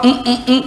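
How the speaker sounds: soft, short high hums; three even little hums for a gap; placeholder hum. Shown as a demonstration. Hums to stand for the blank.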